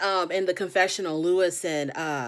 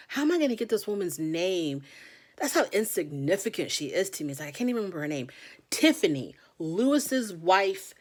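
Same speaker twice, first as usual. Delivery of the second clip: usual speaking voice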